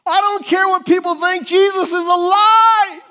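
high, loud, excited declaration; bold joy. Pretend. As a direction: shout it like nobody's watching. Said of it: "you're like".

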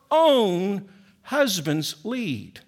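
very slow, "own" stretched and falling; stress on own. Shown as a demonstration. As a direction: draw out "own" slowly and firmly. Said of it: "emphasize that word own".

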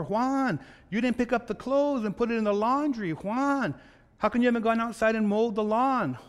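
high, nagging wife voice, each Juan called out. Acted. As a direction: nag him like a fed-up wife.

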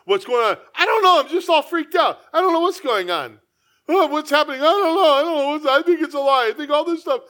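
mock panicked, high and loud; freaked out. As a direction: ham up a frantic worrier. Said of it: "this cantankerous spirit".

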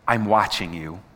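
"I'm watching you" said low, a touch menacing; low, ominous read. Shown as a demonstration. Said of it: "talk about this phrase".

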